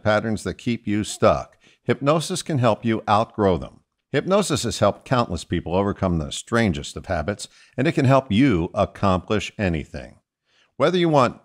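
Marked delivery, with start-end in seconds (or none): none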